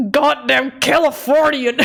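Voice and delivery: mock loud Californian accent. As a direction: ham up a brash Californian accent. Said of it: "like a loud American".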